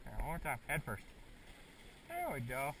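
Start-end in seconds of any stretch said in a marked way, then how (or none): none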